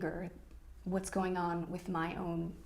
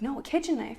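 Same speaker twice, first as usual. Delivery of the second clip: higher, more emphatic retold correction; retold insistence; 6 semitones higher, 3 dB louder, faster than usual. Husband repeats his knife request to wife, insistent retold correction. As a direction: quote him insisting, pressing on kitchen.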